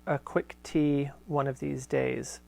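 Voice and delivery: steady, fuller read-aloud voice; letter reading. Acted. Host reading Corinne's letter aloud to listeners, measured reading voice. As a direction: read the old letter out with care.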